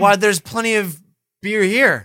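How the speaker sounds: put-on high, loud drunken whine. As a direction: protest like a wasted passenger. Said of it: "I'm so wasted right now".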